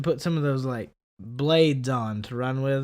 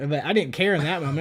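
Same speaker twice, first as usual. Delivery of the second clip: ordinary talk, nothing marked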